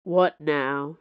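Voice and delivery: usual speaking voice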